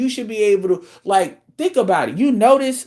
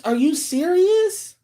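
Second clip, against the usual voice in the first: incredulous; 11 semitones higher, slower than usual. Real, not acted. Mocking woman's money logic.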